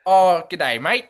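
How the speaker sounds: high, loud mock Australian greeting; Australian accent. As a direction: put on a big Aussie accent. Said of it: "what was his accent like".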